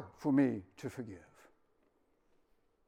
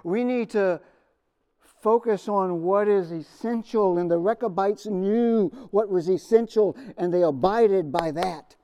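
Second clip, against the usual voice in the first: louder, higher, urgent sermon delivery; fervent preaching; 6 semitones higher, 9 dB louder than usual. Real, not acted. Pastor urging his congregation, in full preaching force. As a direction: press the point home from the pulpit.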